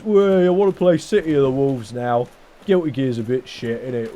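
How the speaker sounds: mock English lad accent; English bloke voice. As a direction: play the football-mad English bloke. Voicing infected English bloke.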